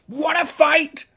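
challenging shout. A narrator's reading. Ladybird picks a fight.

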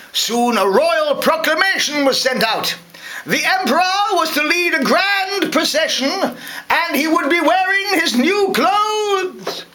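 high-pitched town crier; royal proclamation. Acted. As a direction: proclaim it high, drawing out the end.

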